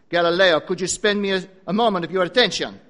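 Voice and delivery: mock Italian professor, loud on the name; a hammed-up Italian teacher; Italian accent. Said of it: "Or probably he said it like this".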